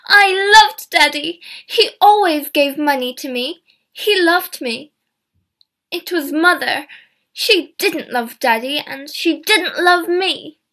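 high, loud tearful pleading; crying. Acted. Diane answers the inspector, crying, high and loud. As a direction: cry out her hurt, slowing at the end. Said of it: "Diane began to cry".

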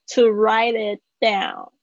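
"To write it down" slowed, with "it" and "down" joined; teacher's careful model; slow linking demo. Shown as a demonstration. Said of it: "这里有一个连音".

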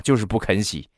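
ordinary talk, nothing marked

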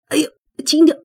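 high, loud ouch and protest; pained yelp. Acted. Wounded godfather protests her rough washing, loud pained yelp. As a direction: yelp as the wound gets pressed. Wounded man yelps while being washed.

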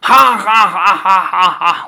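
high booming demon laugh; a trapped demon bursting out laughing; evil triumphant laugh. Acted. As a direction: cackle loud and high like a freed demon king. Demon freed from jar, laughing.

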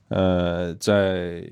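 ordinary talk, nothing marked

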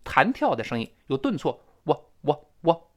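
ordinary delivery

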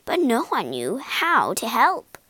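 ordinary delivery, nothing marked

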